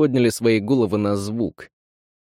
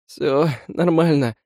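shaky reassurance; 5 semitones higher, slower than usual. Acted. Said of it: "дрожа всем телом".

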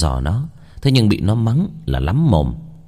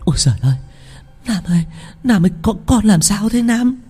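high, fast, choked frightened cry; choked with fear; 12 semitones higher, faster than usual. Acted. Grandmother pleading with her grandson gripping her arm, choked with fear. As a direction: beg him, voice strangled by terror. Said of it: "Bà cụ nghẹn ngào sợ hãi".

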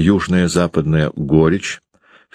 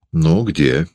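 ordinary delivery, nothing marked

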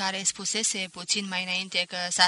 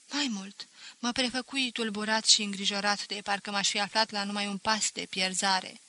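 ordinary delivery

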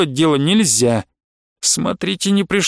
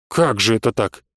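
puzzled question; 6 semitones lower than usual. A narrator's reading. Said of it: "с недоумением спросил".

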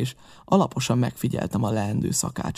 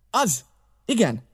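high, quick-spirited agreement; a touch of excitement in a raised pitch; slightly excited exclamation; 9 semitones higher than usual. A narrator's reading. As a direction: seize on the name eagerly. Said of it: "kiáltott föl Silver kissé izgatottan".

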